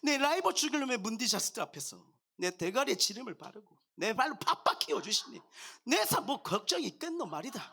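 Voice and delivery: comic Gyeongsang dialect performance, swinging intonation. Shown as a demonstration. Non-native pastor trying Psalm 23 for congregation, playful Gyeongsang dialect. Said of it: "경상도 버전으로".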